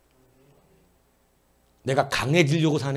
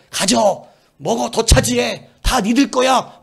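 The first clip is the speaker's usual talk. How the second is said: higher, much louder urging to take it all; excited shouts; 9 semitones higher, 10 dB louder, much slower than usual. Acted. Pastor voices God urging Joshua's generation, shouting eagerly. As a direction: shout it like a rallying cry. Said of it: "막 이런 식으로 나오시잖아".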